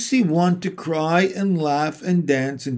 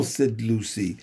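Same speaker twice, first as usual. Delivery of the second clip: plain reading voice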